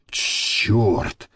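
low, soft swear word; muttered curse. A narrator's reading. Man startled by gunmen next door, muttered curse. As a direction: curse under the breath. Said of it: "выругался Гарик".

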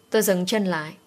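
plain reading voice

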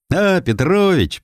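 put-on high, slow cheery old neighbour; neighbour's greeting. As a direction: call out like a pleased old man. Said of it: "приветствовал старик Нефёдов". (старик-сосед, радостно)

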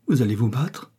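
ordinary delivery, nothing marked

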